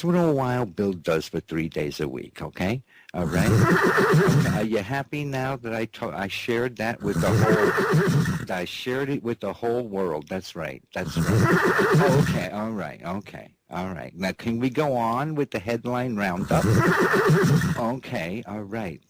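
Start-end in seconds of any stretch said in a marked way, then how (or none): 3.6-4.1 high giggle. Real, not acted. 16.7-17.7 high giggle. Real, not acted.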